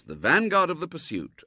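plain reading voice

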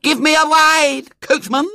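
put-on high, bossy little girl; a spoilt small girl giving orders. A narrator's reading. Violet Elizabeth voiced.

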